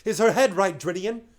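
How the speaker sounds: high, loud commanding shout; shouted orders. Acted. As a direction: shout orders over the oars.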